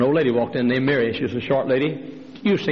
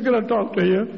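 high woman's voice asking; high timid request; dentist's wife; 9 semitones higher than usual. Acted.

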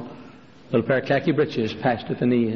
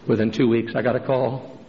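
usual speaking voice

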